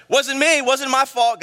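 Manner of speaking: mock defensive Eve; Eve protesting. Eve insists to God it wasn't her fault, mock protesting.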